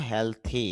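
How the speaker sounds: হেলথি with a breathy th; th in healthy. Shown as a demonstration. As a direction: say হেলথি with th, not d. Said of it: "হেলথি বলবো".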